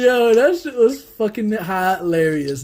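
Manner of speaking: high and slow, laughter in the voice; laughing. Real, not acted. Friend recalling a funny army incident, laughing. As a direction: savour it while still cracking up.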